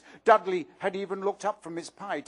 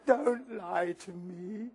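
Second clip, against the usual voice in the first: put-on slow, soft tearful girl; tearful gasp; 5 dB quieter, much slower than usual. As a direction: choke it out through tears. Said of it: "Myrtle gasped".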